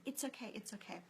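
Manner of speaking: put-on soft, hushed reassurance to a crying baby; soothing. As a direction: murmur comfort to a hurt infant. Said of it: "and I was saying".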